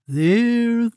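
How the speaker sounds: high lilting soothing there; soothing Mole. A narrator's reading. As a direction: comfort a crying child. Mole comforts Hedgehog.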